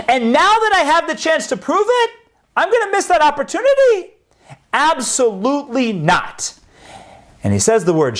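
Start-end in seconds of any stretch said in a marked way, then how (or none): 0.1-6.5 fervent defiance. Acted. Akiva defiant at his death.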